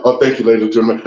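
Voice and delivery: deep, put-on stage voice; mock deep-voiced acceptance speech. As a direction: imitate a singer's low showman thanks. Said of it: "fake as a".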